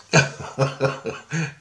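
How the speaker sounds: breathy voiceless chuckling; amused laugh. Real, not acted. Teller finishing his tale of warning his buddy, chuckling. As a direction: chuckle at your own story. Laughs after knifing scenario.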